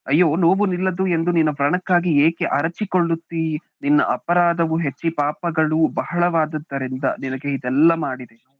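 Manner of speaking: loud, declaimed Bible verse; scripture reading. Acted. Reads the verse aloud. (ಜೋರಾಗಿ ವಚನ ಓದುವ ಧ್ವನಿ)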